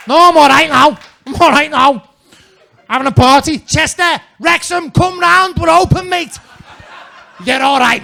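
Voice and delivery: loud, high Scouse holler; shouting Scouser. Retold or imitated. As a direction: bellow it out like a Liverpudlian calling everyone over. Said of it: "they practically screamed their way out of it".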